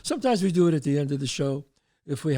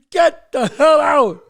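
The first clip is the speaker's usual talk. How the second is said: high, loud, drawn-out yell; furious shout; 10 semitones higher, 10 dB louder, slower than usual. Retold or imitated. Father kicks friend out of house, furious screamed order. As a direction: explode and throw him out.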